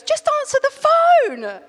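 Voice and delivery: very high, loud and slow; exasperated shout. Retold or imitated. Brother yelling at his sister to take the call. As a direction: yell at her, fed up. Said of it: "And he goes".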